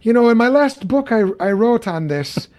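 playful Irish take on Lennox; lightly imitated Irish professor; Irish accent impression. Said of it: "in that great accent".

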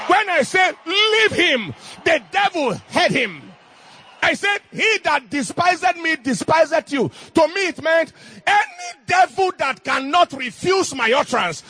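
fiery preacher shout; full-force preaching. Acted. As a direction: proclaim it to the whole church.

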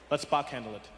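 ordinary delivery

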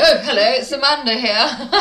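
exaggerated caller greeting, nearly laughing; mock phone greeting. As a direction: announce yourself brightly, hammed up. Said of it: "And then I go".

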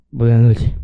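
lightly played drunk, loud and low; drunk greeting. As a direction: blurt it out like a drunk son. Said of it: "Me acuerdo que le dije así".

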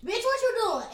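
high, loud excited greeting; loud friendly call. Retold or imitated. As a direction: shout it like calling a friend. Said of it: "we'll call with the".

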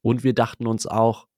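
ordinary delivery

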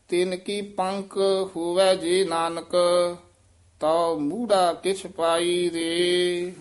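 slow measured recitation of scripture; slow Gurbani recitation. Shown as a demonstration. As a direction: recite each word slowly for students. Teacher recites the verse slowly. (ਹੌਲੀ ਹੌਲੀ ਗੁਰਬਾਣੀ ਪਾਠ)

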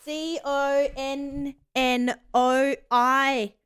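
slow letter by letter; spelling it out. Shown as a demonstration. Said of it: "reading out the right spelling".

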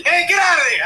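high, loud exasperated yell; mock yell. Thug shouting at the comic to get out, mock yell. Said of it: "He looked at me like I was crazy".

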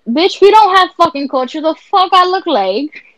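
mock outburst. Retelling the TikTok duet guy's outburst.

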